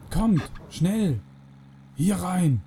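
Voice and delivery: restrained shout, urging them along; urgent calling out. A narrator's reading. Freeman calling the pair into the car, urgent shout.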